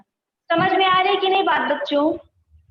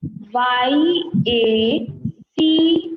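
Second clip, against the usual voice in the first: slow letter-by-letter spelling; 2 semitones lower, much slower than usual. Shown as a demonstration. Teacher spelling a word slowly for kids. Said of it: "तो इसको क्या पढ़ेंगे बच्चों".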